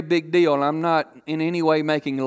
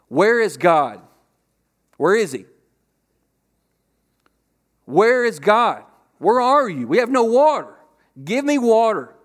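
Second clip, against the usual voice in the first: loud, building cry of complaint; acted-out outraged questioning; indignant demanding; 4 semitones higher, 4 dB louder than usual. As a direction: demand answers, pitch climbing as it builds. Acting out Israelites demanding God and water.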